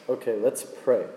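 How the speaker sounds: ordinary delivery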